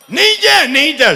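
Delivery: high-pitched repeated warning; mock alarmed warning. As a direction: fuss in exaggerated alarm. Family warns toddler again. (ઊંચા સૂરે વારંવાર રોકટોક)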